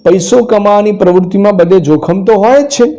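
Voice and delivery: ordinary talk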